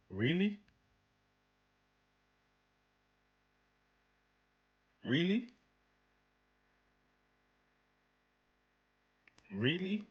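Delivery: a pointed, drawn-out really with long pauses; exaggerated sarcastic double-take; sarcastic disbelief. Real, not acted.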